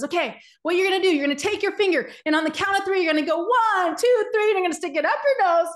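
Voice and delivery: rally the crowd like a hyped motivational speaker; hyped seminar coach. Retold or imitated. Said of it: "Tony Robbins says".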